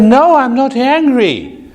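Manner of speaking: high, forceful mock-angry denial; emphatic denial. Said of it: "talk loud and, uh, I give some emphasis".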